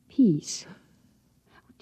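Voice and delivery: a breathless, whispered gasp of shock. Acted. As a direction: barely breathe the word out in dismay. Shocked gasp after saying peace.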